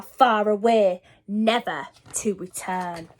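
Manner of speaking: plain reading voice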